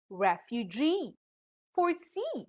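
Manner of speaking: "refugee, foresee" landing on GEE and SEE; last-syllable stress. Shown as a demonstration. Teacher giving examples of a rule, "refugee, foresee" stressed at the end. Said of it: "usually carry stress on the last syllable".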